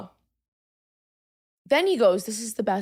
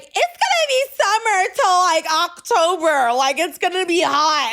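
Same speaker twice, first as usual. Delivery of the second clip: high, mocking know-it-all voice; mocking Laura; 4 semitones higher, 3 dB louder than usual. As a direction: mimic the coworker smugly. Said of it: "and she's like".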